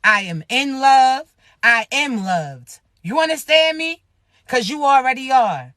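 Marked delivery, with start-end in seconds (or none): 0.1-2.8 slow, deliberate self-affirmation. Shown as a demonstration. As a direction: say each line slowly, like a vow to yourself. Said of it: "I want you to say this right now".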